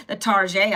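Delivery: Target said as "tar-ZHAY"; mock-French Target. Repeats playful mock-French name.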